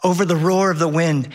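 ordinary talk, nothing marked

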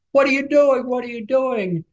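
high, held-back shout; retold shout. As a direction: imitate someone calling out in alarm at normal volume. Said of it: "Sonny's shouting".

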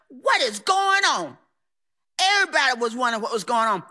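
usual speaking voice

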